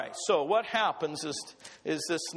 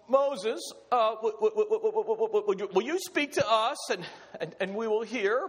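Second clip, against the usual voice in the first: stammering terrified crowd; 2 semitones higher than usual. Acted. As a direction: stutter it out as if too scared to speak. Terrified people stammering to Moses.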